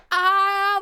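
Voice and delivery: short high, loud mock scream "ah"; retold scream. Woman with fingers up her nose, mock scream. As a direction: yelp as if fingers were jammed up your nose. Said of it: "like, screaming and shit".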